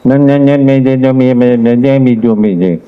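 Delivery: garbled, mushy nonsense syllables in one unbroken stream; unintelligible mumble. Shown as a demonstration. Judge showing students how not to speak, unintelligible mumbling. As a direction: run the sounds together into gibberish. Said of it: "तो अगर ऐसे बोलोगे".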